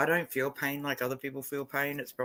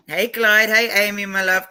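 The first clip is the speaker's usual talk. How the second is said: loud, higher-pitched hello to newcomers; calling out a greeting; 6 semitones higher, 10 dB louder than usual. Real, not acted. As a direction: hail arriving friends loudly and affectionately.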